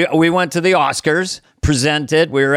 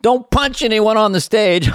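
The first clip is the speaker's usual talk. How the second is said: chummy driver; 7 semitones higher than usual. Retold or imitated. Limo driver voiced, joking.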